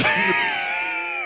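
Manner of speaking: very high, piercing laugh; helpless shrieky laugh; high squealing laugh. Real, not acted. Reacts to the played clip.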